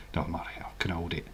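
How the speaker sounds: fast hushed whisper; quick whisper. Real, not acted. Admitting to viewers he can hold it, in a quick whisper. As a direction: rush it out under your breath. Embarrassed aside about needing wee.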